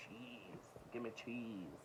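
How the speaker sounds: a hushed, teasing aside about cheesy covers; mock cheesy murmur. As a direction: savour the cheese jokingly under your breath. Joking about cheesy cover art.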